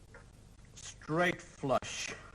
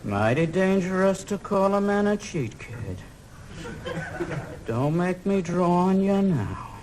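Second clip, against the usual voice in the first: menacing cowboy; 3 semitones lower, 3 dB quieter, slower than usual. Acted. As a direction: threaten him like a gunfighter. Gunfighter warns kid.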